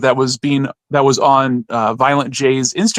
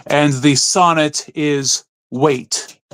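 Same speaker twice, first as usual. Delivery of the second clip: usual speaking voice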